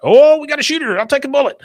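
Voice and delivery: high, loud bodyguard shout; boy hamming up a bodyguard panic; mock secret service alarm. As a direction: yell it like you're diving for the president. [name] playing Secret Service.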